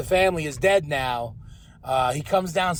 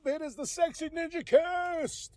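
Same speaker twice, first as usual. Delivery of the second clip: high, drawn-out hollered greeting; big high-pitched host's welcome; hype show intro; 11 semitones higher than usual. Pretend. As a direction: holler the podcast opening way up high. Shouted show-opening greeting.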